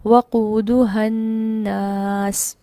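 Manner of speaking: वक़ूदुहन्नास chanted very slowly, ha short, doubled n; joined recitation. Shown as a demonstration. Teacher recites the full joined phrase after the isolated word. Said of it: "यहाँ पर हा अलग से इसको लंबा नहीं किया गया".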